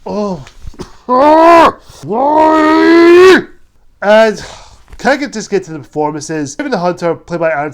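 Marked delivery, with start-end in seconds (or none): 0.5-3.4 a sudden harsh, loud coughing fit; loud choking cough. Real, not acted. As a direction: cough hard, high and loud. Loud cough mid-rant.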